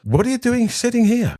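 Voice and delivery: high, put-on voice of an indignant mother; mum's indignant question. Mother to daughter, indignant at the poor table, high mock voice. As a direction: demand it like a mother affronted on her daughter's behalf. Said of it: "He walked over and says".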